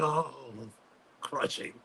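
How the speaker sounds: whispered, pained crushing. Real, not acted. Fan still hurt years later, whispering crushing. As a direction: breathe the word out like it still hurts. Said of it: "Fifteen years later, it's like".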